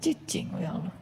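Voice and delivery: whispered hush; whispered plea. Pretend. Mock worshipper whispering to God to stay quiet. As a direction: breathe it in a whisper.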